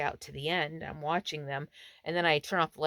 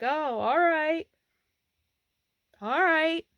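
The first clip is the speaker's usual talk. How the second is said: high, loud, drawn-out acknowledgement; imitated local stranger; 11 semitones higher, 7 dB louder, slower than usual. Stranger accepting the camper's story, imitated local. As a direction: call back easily from outside, pitched up. Stranger's called-out reply, acted.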